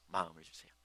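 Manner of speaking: ordinary talk, nothing marked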